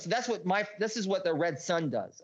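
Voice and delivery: ordinary talk, nothing marked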